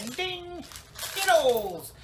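high ringing "ding", then loud "Skittles"; magical exclamation. Acted. Showing the hippie how wishes appear, high excited exclamation. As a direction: exclaim it high, like candy popping up. Demonstrates wishing candy into being.

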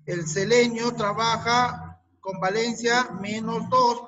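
ordinary talk

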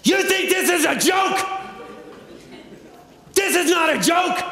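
high shouted mock fury; angry banker shout. Banker yelling down the phone at the storyteller, high and furious. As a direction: bellow it like an outraged bank officer.

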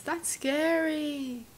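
high, soft, very drawn-out dread; creeped out. Real, not acted. Reader reacting to a frightening story, creeped out. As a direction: stretch it into a small whine.

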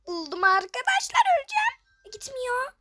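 loud, high-pitched relieved cry; excited scream. Real, not acted. Gamer to viewers after a close call, excited scream. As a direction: squeal with near-death relief. Narrow escape in game.

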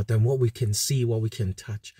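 ordinary talk, nothing marked

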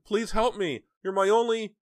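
very high, loud cry for help; frantic begging. A narrator's reading. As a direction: plead in panic until cut off. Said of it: "she begged again".

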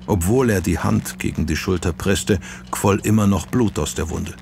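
ordinary delivery, nothing marked

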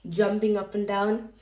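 ordinary delivery, nothing marked

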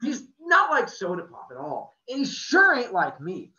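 put-on teen boy bursting out bitterly about his brother; bitter outburst. A narrator's reading. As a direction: spit it out with hurt and resentment. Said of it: "I just burst out bitterly".

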